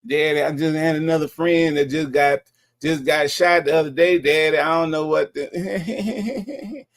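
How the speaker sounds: lightly whining mimicry of the caller; whiny caller impression. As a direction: whine it like a grown man sounding fifteen. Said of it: "the way this dude sound on the phone".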